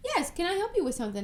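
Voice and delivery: high, fast chirpy clerk; cashier voice. Retold or imitated. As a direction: lightly mimic a chipper cashier. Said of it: "cash register would be like".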